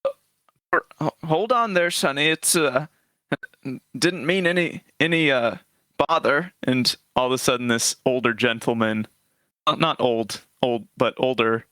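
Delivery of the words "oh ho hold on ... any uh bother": put-on higher, halting old man; frail grandfather. Old man calms the gate guard, frail grandfatherly voice. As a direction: stammer mildly like a harmless grandpa. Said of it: "frail, old grandfatherly figure".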